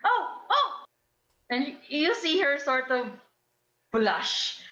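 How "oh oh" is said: startled. Acted. Diana realizes her mistake.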